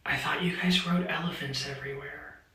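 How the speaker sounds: whispered, dead-serious. Retold or imitated. Retelling the girl's elephant question, whispered in earnest. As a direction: hush it like a secret.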